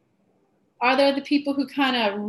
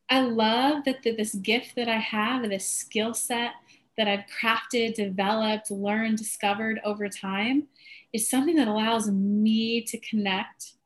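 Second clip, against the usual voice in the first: slow savoring; slower than usual. Shown as a demonstration. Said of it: "we're gonna do it a little bit for you".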